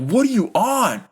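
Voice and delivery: high, disbelieving retort at the refs; incredulous outrage. Retold or imitated. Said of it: "I'm like".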